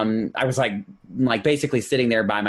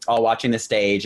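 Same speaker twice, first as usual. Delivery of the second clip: usual speaking voice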